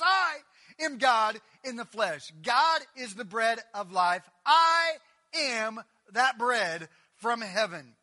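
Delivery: shouted preaching. Acted.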